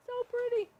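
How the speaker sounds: high, delighted coo over the corn; a sudden high squeal of delight. Real, not acted. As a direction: gush over how pretty it looks. Admiring the cooked corn.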